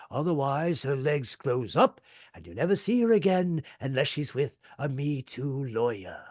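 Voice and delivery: hushed-style British documentary voice, without a true whisper; British nature narrator. Acted. British narrator tracking women in a singles bar, mock-documentary accent. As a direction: narrate in a put-on British accent, savoring each phrase. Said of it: "a British narrator, and he's always whispering".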